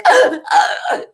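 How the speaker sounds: high, loud mock sob; loud hammed-up seal-bark crying. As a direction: imitate a child's barking, gasping sob. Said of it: "seal barking, crying".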